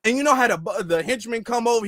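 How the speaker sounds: normal talking voice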